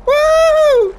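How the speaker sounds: high, loud, drawn-out woo; long celebratory whoo; high whoop. Real, not acted. Cheers during play.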